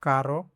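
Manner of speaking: कारो with a single tapped r; कारो, light one-tap r; Spanish caro. Shown as a demonstration. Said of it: "पेरो मतलब but". ("कारो" में हल्का र)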